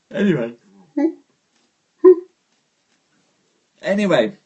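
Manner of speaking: words stretched by a yawn. Real, not acted. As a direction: say it mid-yawn. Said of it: "sorry for the yawn there".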